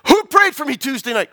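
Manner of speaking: high, insistent cross-examining question; attorney-like demand. Retold or imitated. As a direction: interrogate as a daughter demanding the truth. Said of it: "like an attorney".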